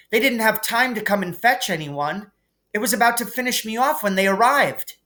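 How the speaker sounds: high, fast, eager schoolgirl; Hermione's voice. A narrator's reading. Hermione lies to McGonagall to cover for the boys, hurried. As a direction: rush the excuse out like a know-it-all.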